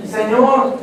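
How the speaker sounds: ordinary talk, nothing marked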